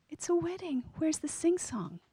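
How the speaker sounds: disappointed whisper. Retold or imitated. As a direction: whisper it to yourself, crestfallen. Sad disappointed inner thought.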